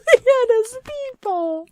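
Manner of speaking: high laughing echo. Real, not acted. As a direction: repeat it through laughter. Laughing repeat of joke.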